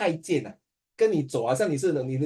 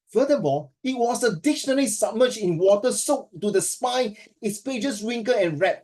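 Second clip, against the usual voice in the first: slow, higher reading of a student's essay; teacher reading a student passage with relish; dramatic reading; 5 semitones higher than usual. Acted. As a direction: savour each phrase as you read. Reads student's essay aloud.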